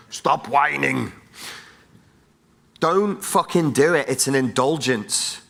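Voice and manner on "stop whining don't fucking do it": ordinary talk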